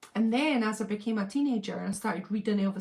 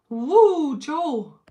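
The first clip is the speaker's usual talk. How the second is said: loud, high whoop; excited whoop; 6 semitones higher, 10 dB louder than usual. Real, not acted. Cheering a viewer named in the chat, loud whoop.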